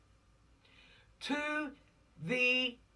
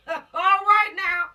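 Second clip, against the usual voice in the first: a high, loud, slow "All right now"; exclaiming; 10 semitones higher, 11 dB louder, slower than usual. Real, not acted. Preacher rallying the congregation, loud and drawn out. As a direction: stretch it out big and high.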